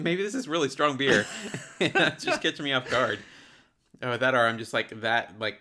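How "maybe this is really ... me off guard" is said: talking through a laugh, higher pitch. Real, not acted. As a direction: laugh while making the excuse. Cracking up while blaming the beer.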